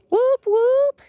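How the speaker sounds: high, loud "whoop, whoop"; celebratory whooping. Pretend. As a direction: whoop it up for the new listeners. Cheers for new listeners.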